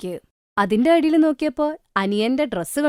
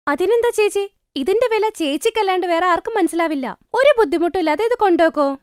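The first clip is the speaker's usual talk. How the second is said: higher, bright-pitched reassuring sister-in-law; a higher voice for the sister-in-law urging her to take the box; 9 semitones higher, 4 dB louder than usual. A narrator's reading. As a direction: lift the pitch to play the kind sister-in-law.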